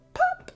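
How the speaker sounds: high, loud popping sound; pop sound effect. A narrator's reading. Button popping off the mattress, sharp pop.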